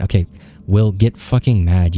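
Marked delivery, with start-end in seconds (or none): none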